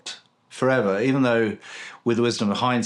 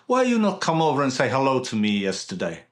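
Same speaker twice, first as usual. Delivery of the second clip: mock Russian accent, reproachful question; 3 semitones higher than usual. Hairdresser asking why you didn't say hello, mock Russian accent. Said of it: "I can only do a Russian accent".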